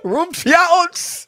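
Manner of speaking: high, harsh made-up alien words; fake Klingon. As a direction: bark out pretend Klingon gibberish. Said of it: "Is that Klingon?".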